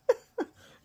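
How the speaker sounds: sudden high, loud laugh; a real, bright laugh bubbling up; can't hold back a laugh; burst of laughter. Real, not acted. Laughing at her own story.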